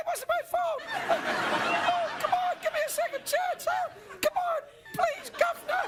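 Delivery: squeaky high cockney pleading; hammed-up falsetto cockney beggar; cockney tie. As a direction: beg like a tiny cockney urchin.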